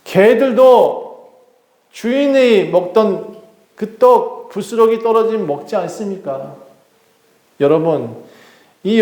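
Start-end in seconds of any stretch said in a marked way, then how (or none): 0.0-6.5 higher, slow and drawn-out appeal; earnest plea. Acted. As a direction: stretch each phrase, pleading the case. Same verse re-voiced as woman's plea. (높고 느리게 힘주어 호소)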